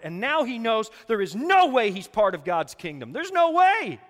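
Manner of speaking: loud, high cry of despair; preacher's climax on Peter's despair; preaching climax. Acted. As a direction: build to a loud peak on no way.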